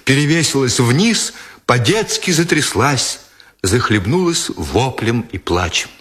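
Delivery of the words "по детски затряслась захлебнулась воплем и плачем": plain reading voice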